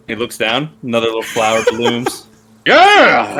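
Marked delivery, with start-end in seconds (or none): 2.7-3.4 much higher, louder gleeful shout; excited yell. Acted. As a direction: horse crowing with delight. GM voices excited horse.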